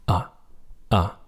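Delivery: AH said short and light; touch AH briefly and lightly. Shown as a demonstration. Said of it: "a light short tone".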